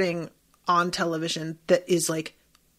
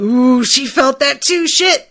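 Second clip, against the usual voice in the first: loud and high, giddy with delight; excited squeal; 5 semitones higher, 9 dB louder than usual. Retold or imitated. Viewer reliving the flirt scene, loud giddy squeal. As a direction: squeal like you just caught the spark. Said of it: "And I was like".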